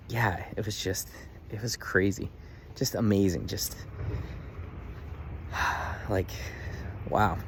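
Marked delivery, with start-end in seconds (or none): 3.8-6.0 amazed sigh. Real, not acted. Amazed at mom's change.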